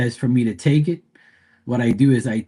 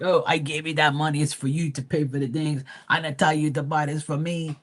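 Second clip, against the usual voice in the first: mom mad; 3 semitones higher, 4 dB quieter than usual. Retold or imitated. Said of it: "she's like, all mad".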